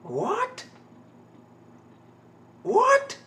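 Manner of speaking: can't believe what you're seeing; a squeaky, rising What; shocked disbelief. Real, not acted. Repeated shock at the scene.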